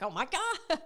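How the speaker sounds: high laughing oh my God; quoting herself, laughing. Retold or imitated. Friend on the phone, a laughing gasp. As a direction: squeal it through a laugh. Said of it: "And I'm like".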